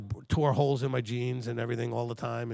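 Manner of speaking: usual speaking voice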